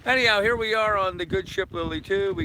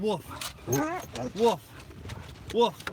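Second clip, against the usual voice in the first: mock dog bark; 6 semitones higher, 7 dB quieter than usual. Coaxing own dog to bark.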